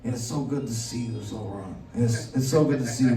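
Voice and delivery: low, soft godfather rasp-free murmur; mafia don voice. Acted. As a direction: speak like a mob boss leaning in. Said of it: "Did your voice just change?".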